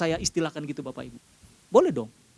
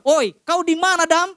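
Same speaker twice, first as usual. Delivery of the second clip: higher, louder Sumatran-style call; Sumatran accent; 12 semitones higher, 5 dB louder than usual. Retold or imitated. Preacher jokes God calling Adam, Sumatran accent. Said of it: "versi orang Sumatera".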